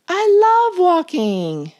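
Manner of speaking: high, loud, delighted beaver voice; gushing beaver. A narrator's reading. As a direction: gush it like it's the best thing ever. Beaver gushes about walking.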